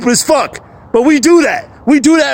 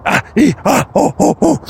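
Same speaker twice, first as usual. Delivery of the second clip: wild vocalizing; 5 semitones higher than usual. Real, not acted. Expressing himself in the woods.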